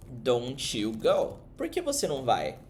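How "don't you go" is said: "don't you go" stretched slowly word by word; slow model. Shown as a demonstration. Teacher modelling a suggestion, drawn out slowly. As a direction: lay it out slowly for students. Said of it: "você vai dizer".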